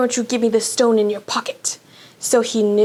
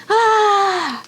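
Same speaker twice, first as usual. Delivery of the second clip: pained cry; 9 semitones higher than usual. Acted. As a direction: cry out as the skin burns. Quirrell burned by Harry's touch.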